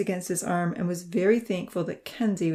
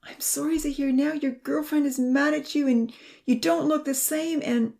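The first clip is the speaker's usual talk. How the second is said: upset; 8 semitones higher than usual. A narrator's reading. Ponzi upset and guilty.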